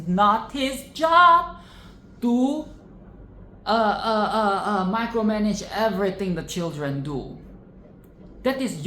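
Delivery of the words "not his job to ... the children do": loud rant. Real, not acted. Ranting at parents.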